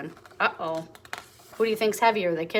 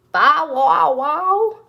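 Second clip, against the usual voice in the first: higher, loud pretend barking; dog noise; 6 semitones higher, 6 dB louder, much slower than usual. Elephant pretending to be a dog, barking. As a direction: bark like a big friendly dog. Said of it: "That was my best dog noise".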